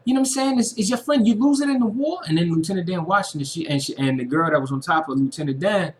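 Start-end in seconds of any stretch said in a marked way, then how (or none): 0.0-2.2 high, louder mock woman's taunt; mocking harlot voice. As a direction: sneer it in a high put-on woman's voice. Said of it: "she was like".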